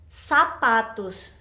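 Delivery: "zapatos" with an s sound, long hissing final s; z said like s, drawn out. Shown as a demonstration. Teacher showing students z as s. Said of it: "Vamos a pronunciar la Z como S".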